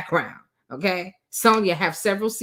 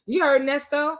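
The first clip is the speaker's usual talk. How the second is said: ordinary talk, nothing marked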